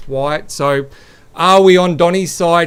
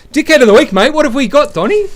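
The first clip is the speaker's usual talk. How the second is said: high, loud announcer call; game show host announcing the segment; hyped segment intro; 10 semitones higher, 7 dB louder than usual. Pretend.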